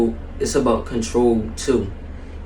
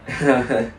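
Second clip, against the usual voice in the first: light laugh. A narrator's reading.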